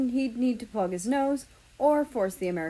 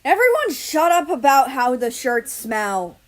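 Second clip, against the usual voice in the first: loud, higher exasperated outburst; angry snap; 6 semitones higher, 9 dB louder than usual. Acted. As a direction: bark it at the whole room. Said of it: "Britain snapped".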